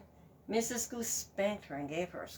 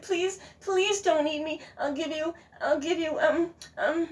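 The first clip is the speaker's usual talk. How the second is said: pleading goose; 9 semitones higher, 6 dB louder than usual. Acted. Goose begging the fox not to eat her, pleading. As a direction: beg for your life.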